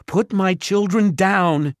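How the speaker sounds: high, loud frantic cry; panicked mother. A narrator's reading. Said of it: "Their mother's voice was panicked".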